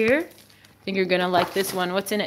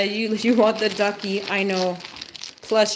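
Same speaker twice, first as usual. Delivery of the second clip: normal talking voice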